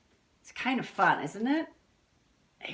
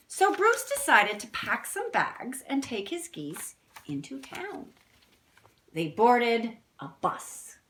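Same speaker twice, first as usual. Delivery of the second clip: storybook reading; 4 semitones higher than usual. A narrator's reading. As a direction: read it like a picture book to kids. Reads the book text.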